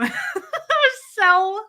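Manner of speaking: high, slow, laughing through words; can barely speak for laughing. Real, not acted. Wife telling her audience, laughing hard. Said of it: "laughing while telling".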